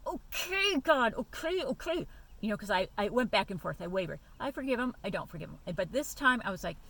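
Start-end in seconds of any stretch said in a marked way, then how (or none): 0.1-2.1 surrender. Retold or imitated. Surrendering to God retold.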